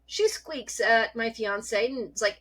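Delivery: ordinary talk, nothing marked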